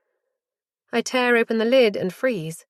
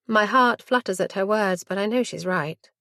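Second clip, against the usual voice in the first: usual speaking voice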